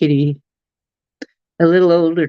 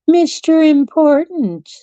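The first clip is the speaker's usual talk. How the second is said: high, drawn-out mock fanfare; mock-grand announcement; 10 semitones higher, 4 dB louder than usual. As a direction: announce the cat slowly, pitched way up. Teasing, grand repeat of cat's nickname.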